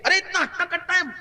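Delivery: mock a passer-by shooing off a strong beggar; contemptuous scolding. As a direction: wave the beggar away with disdain. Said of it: "کہتے ہیں".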